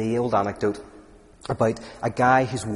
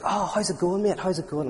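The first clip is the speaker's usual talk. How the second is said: lightly acted, higher-pitched hello to a mate; mock matey greeting; 7 semitones higher than usual. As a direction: greet your pal, pitched up. Acted greeting of friend on beach.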